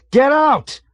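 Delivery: very high, very loud hysterical yell. A narrator's reading. Terrified pilot yells hysterically at navigator. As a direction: scream it out of control. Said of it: "Yossarian yelled hysterically".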